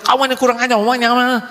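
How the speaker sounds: high mocking jeer; a high, taunting put-down; mock insult. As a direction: jeer like a rude stranger. Voicing someone insulting you.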